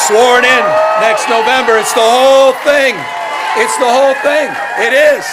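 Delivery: loud, high rallying shout; rally speech. Acted. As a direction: rouse the crowd to cheer.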